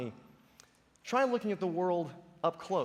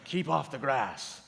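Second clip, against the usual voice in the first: stern sign voice; 4 semitones lower, slower than usual. Retold or imitated. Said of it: "we have these signs".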